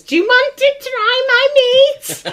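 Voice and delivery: slow, high spooky voice with laughter. Retold or imitated. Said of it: "all I heard was".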